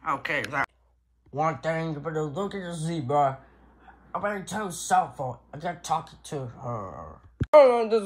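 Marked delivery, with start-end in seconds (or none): none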